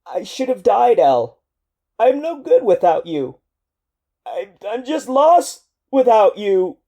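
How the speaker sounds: broken soldier telling El he is lost without her, voice shaking. Acted. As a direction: let the voice quiver as he gives up. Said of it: "The shaking of your voice".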